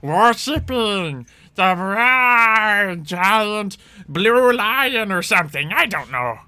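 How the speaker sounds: rambling goblin boss, stretched-out words; goblin chief. Acted. Game master voices goblin chief.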